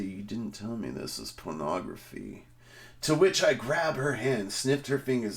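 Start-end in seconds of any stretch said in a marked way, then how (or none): 0.0-2.4 much softer whispered complaint; hushed. Retold or imitated. Woman beside him whispering her complaint, hushed. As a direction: lean in and hiss it under your breath.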